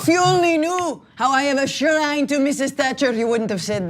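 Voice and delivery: put-on a laughing, drifting Scottish-ish British accent; mock the British host in a half-Scottish accent; wobbly Scottish accent. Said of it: "I don't really know what accent I'm going there".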